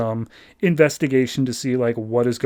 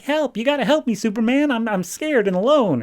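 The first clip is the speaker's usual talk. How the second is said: put-on much higher, childlike pleading voice; scared little kid; 9 semitones higher than usual. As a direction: beg for help like a frightened child. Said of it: "there's a little kid just running around".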